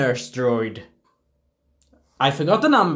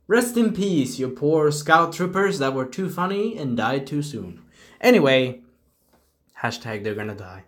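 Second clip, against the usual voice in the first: ordinary delivery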